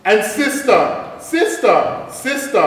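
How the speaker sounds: high, loud sing-song calling into the cave; quacking call. Acted. The duck calls into the cave, high loud sing-song. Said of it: "She quacked".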